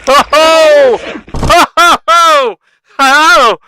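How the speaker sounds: high, loud excited oh's; excited outcry. Real, not acted. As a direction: yell with delight. Reacting to thrilling clip.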